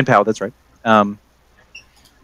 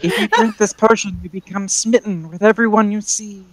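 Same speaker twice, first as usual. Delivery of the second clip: put-on higher-pitched old lady voice; old lady shopkeeper; 8 semitones higher than usual. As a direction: play an elderly woman minding her shop. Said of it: "burp in the old lady voice".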